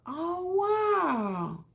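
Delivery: high teary wow; overwhelmed. Real, not acted. Host moved by viewer's true story, teary wow. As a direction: near tears from surprise.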